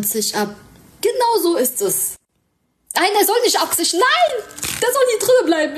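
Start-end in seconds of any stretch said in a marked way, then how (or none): 3.0-5.8 high, loud, fast protest at the screen; excited yelling at game. Real, not acted. Streamer yelling at her game, high and loud. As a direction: shout it as the game goes wrong.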